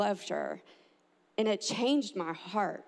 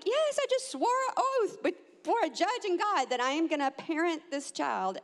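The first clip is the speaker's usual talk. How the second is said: high, emphatic retort; emphatic insistence; 6 semitones higher than usual. Retold or imitated. Mother answering her sons, high and emphatic. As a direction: insist on it, pitched up.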